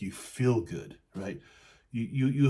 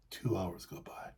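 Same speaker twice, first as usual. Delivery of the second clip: whispering; 3 semitones lower, 9 dB quieter than usual. A narrator's reading. Time passing in fable.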